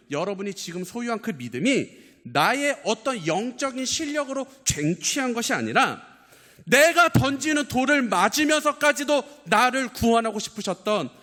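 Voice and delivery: high, loud preaching cry; impassioned preaching. Real, not acted. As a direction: preach it at full pitch, pressing every phrase. Preacher at full force.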